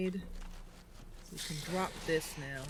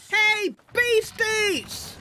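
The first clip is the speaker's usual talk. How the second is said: long drawn-out high yell; big booming character yell, pitched way up; yelled greeting; 10 dB louder, much slower than usual. Acted. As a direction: holler it out loud, stretching each word. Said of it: "he opens the door and he yells".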